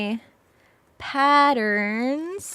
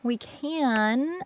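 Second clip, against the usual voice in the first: ordinary talk, nothing marked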